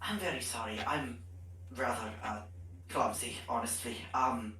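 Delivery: low, halting, awkward apology; Bedlam apologizing. Acted. Clumsy character apologizes to an angry goblin, awkward and halting. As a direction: stumble through it in a low character voice. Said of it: "he kinda looks at her and he goes".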